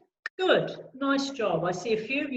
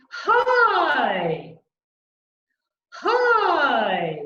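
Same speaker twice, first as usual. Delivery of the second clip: big drawn-out "Hi" swooping up then sliding down; surprised, lifted; 3 semitones higher, 7 dB louder than usual. Shown as a demonstration. Coach showing choir a raised soft palate, surprised and lifted. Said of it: "let's try raising that soft palate".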